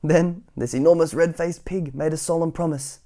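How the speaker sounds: energetic bedtime-story narration; reading to children. A narrator's reading. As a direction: tell it big for little listeners.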